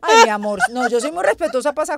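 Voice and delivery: loud, fast, emphatic protest; loud on purpose. Real, not acted. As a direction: proclaim it, this is your space to shout. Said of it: "Aquí grito porque este es mi espacio".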